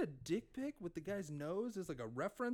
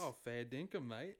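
playful Australian impression; Australian accent; 6 semitones lower, 8 dB quieter than usual. Friends trade bad accents on a podcast, Australian accent. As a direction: put on an easy Aussie lilt. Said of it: "doing Australian".

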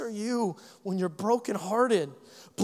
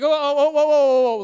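mock alarmed whoa; 4 semitones higher, 6 dB louder than usual. Mock road rage reaction.

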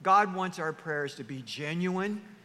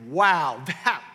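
hammed-up admiration; exaggerated wowed outburst; mock awe; 3 semitones higher, 5 dB louder than usual. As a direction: play an impressed listener, bursting with wonder. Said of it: "you hear someone's prayer and you think".

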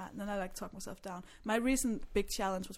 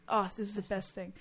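ordinary talk

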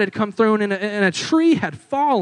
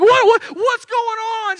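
high, slow, stammering alarm; much higher, slow alarmed stammer; alarmed question; 13 semitones higher, 4 dB louder, slower than usual. Retold or imitated. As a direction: ask it much higher and slowly, caught off guard. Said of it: "Tears, panic, anxiety".

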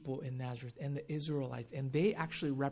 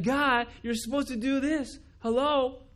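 much higher, louder entitled demand; demanding complaint; 10 semitones higher, 8 dB louder than usual. Retold or imitated. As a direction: whine at God like he owes you.

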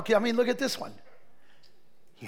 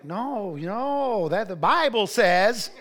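slow preachy lecture; 3 semitones higher, 3 dB louder, slower than usual. Retold or imitated. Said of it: "she's like".

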